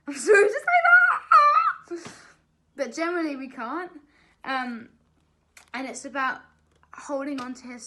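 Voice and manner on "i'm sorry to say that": loud, high burst of real laughter; laughing apology. Real, not acted. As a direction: squeal with laughter while apologising.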